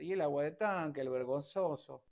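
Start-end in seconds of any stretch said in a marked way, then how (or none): none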